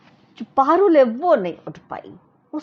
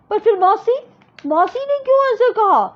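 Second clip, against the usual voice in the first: put-on sulky little girl; 11 semitones higher, 5 dB louder than usual. Parul objecting to her elder sister. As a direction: whine the protest to didi.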